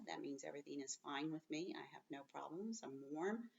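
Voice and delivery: usual speaking voice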